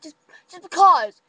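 loud, high huffy "because!"; indignant shout. Retold or imitated. Rachel refusing to explain to her boyfriend, loud huffy shout. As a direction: snap him off.

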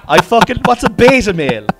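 high, loud, comic bafflement; loud incredulity. Real, not acted. Cohost reacting to an unfamiliar term, loudly incredulous. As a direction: exclaim it in loud, high-pitched disbelief.

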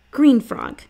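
put-on a kid blurting out guesses to his sister; young boy guessing. A narrator's reading. Boy guessing frog names for his sister, eager and high.

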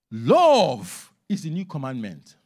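slow and weighty, every word pressed; very emphatic. Shown as a demonstration. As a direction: drive the whole line home slowly. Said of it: "I want to say this very emphatically".

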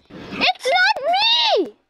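high, drawn-out squealed denial; loud, slow, squeaky plea of innocence; mock protest. Playful denial as secret traitor.

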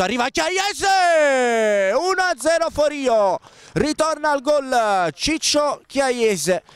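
drawn-out high goal shout; breathless italian goal commentary. Real, not acted. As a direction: scream the goal call, stretching it. Goal scored, commentator erupts.

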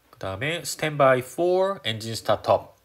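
slow, careful radio readback. Shown as a demonstration. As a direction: spell out the readback slowly. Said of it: "이런 식으로 대답을 해주시면 될 것 같은데".

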